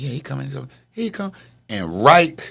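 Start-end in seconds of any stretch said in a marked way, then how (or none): 0.0-1.3 hushed reply. Retold or imitated. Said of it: "And you're talking".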